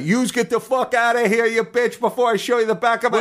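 high-pitched mock New Jersey tirade; New Jersey tough guy. Vacuum inventor threatening his wife, mock-screamed New Jersey accent. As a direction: yell like a New Jersey husband, without real volume. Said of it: "he screams at his wife every night".

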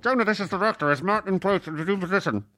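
high, put-on walkie-talkie voice; director on walkie. Director calling his assistant over a walkie, in a distorted high voice. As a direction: squawk it like a radio message. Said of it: "I have a, a walkie".

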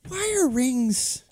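exasperated. Real, not acted. Exasperated rant on rings.

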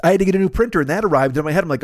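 ordinary talk, nothing marked

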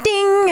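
high sung ding like a notification; chime imitation. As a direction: chirp it like a watch alert. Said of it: "my watch is always like".

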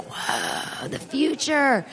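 breathy, drawn-out whoa of wonder; hushed, exaggerated amazement; mock awe. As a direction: act out a kid marveling at the future. Child awed by media center.

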